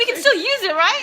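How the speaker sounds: very high, loud, through giggles; squealed, amused appeal; laughing hopeful plea. Retold or imitated. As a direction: retell a daft hopeful question while cracking up. Retelling hopeful question, laughing.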